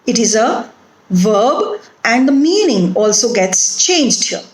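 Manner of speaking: normal talking voice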